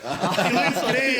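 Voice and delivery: slow, loud and laughing. Real, not acted. Teasing friends about the pale DJ, laughing. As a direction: stretch it out through a laugh.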